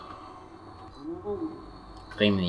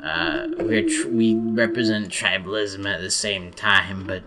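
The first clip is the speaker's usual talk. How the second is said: slow, hammed-up French accent; mock French accent. French people praise and damn themselves, slow mock French accent. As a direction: drag it out in a self-important French accent. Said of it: "here's some more French people".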